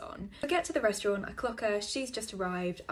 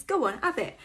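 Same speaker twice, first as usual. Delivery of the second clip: nagging mother voice; 7 semitones higher, 8 dB louder than usual. Retold or imitated. Date urging him to eat up, in a high motherly coax. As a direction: push food on him like a mum. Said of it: "my mother would say".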